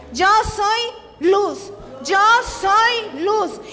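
slow, emphatic proclamation; declaration to repeat. Shown as a demonstration. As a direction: lead the room in a drawn-out declaration. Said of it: "repita conmigo".

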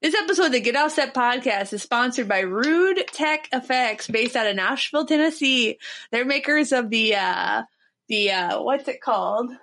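hammed-up Minnesota ad read; playful Minnesota accent sponsor read. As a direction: put on a thick Minnesota accent for the ad. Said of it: "when you first started that accent".

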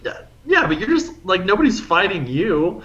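high-pitched, exasperated pushback; incredulous protest. Retold or imitated. Bystander arguing with the self-defense bouncer, incredulous. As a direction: reason with him in high disbelief.

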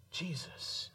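breathy whisper of the name; whispered answer. Real, not acted. Preacher answering his own question in a hushed whisper.

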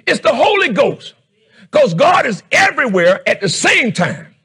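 loud, fervent preaching to the congregation; preaching at full force. Acted. Pastor preaching to the church, full-force shouting. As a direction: raise the voice and drive it home.

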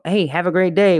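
high, bright sing-song greeting to a clerk; cheery goodbye. Shown as a demonstration. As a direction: chirp it out as you leave the store.

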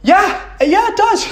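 very high squeaky agreement then laugh; patient squeaking agreement to the therapist. Pretend. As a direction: answer in a tiny high voice.

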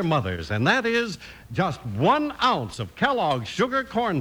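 upbeat pitch to the mothers; radio ad read. Acted. Announcer pitching cereal to mothers, upbeat ad read. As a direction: sell it brightly to the listening moms.

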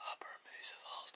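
breathy whisper. Shown as a demonstration. Showing viewers his breathed-out trick, whispered. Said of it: "But I say it with my breath".

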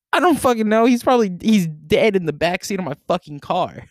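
high, loud reenacted outburst; frantic exasperation. Driver reenacts frantic call about passed-out friend, exasperated. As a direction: blurt it out high, at wit's end.

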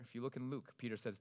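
ordinary talk, nothing marked

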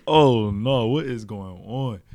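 loud, slow, stunned reaction; shocked disbelief. Retold or imitated. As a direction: stretch it out in amused shock. Shocked reaction retold, laughing.

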